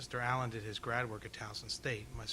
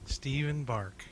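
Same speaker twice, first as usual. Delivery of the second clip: said louder for the crowd; louder repeat; 2 semitones higher, 8 dB louder, slower than usual. Real, not acted. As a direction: speak up so everyone hears. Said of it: "Tell 'em again one more time".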